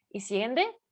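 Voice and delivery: ordinary talk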